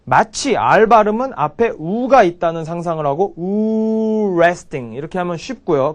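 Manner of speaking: usual speaking voice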